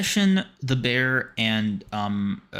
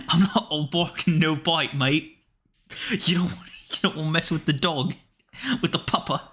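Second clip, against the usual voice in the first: higher-pitched mock British hardman accent; bad British accent; 5 semitones higher than usual. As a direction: threaten like a cartoon British thug. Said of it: "An ass fucking impersonation".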